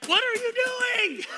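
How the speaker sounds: high, drawn-out yell of exasperation; loud exasperated shout. Retold or imitated. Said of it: "I'm being very loud".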